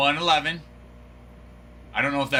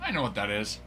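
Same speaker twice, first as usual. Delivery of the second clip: usual speaking voice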